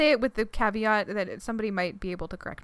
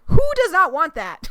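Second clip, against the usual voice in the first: much louder, higher exclamation; 7 semitones higher, 13 dB louder than usual. Real, not acted. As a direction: belt it out, incredulous. Emphatic rhetorical repeat.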